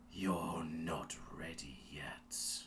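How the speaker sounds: slow, hushed menacing whisper. Acted. Dark warrior whispering a taunt to the fallen Jedi, menacing whisper.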